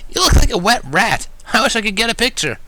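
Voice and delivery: high, loud mocking tease; teasing. Dash teasing the soaked narrator, high mocking voice. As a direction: rib your friend with mock affection. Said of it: "in an oh-so-loving fashion".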